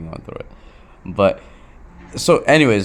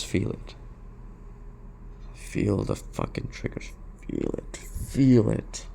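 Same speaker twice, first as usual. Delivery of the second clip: hushed, voiceless urging; intense whisper; 9 dB quieter, slower than usual. Real, not acted. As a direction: breathe it out under your breath. Experiencing triggers right now.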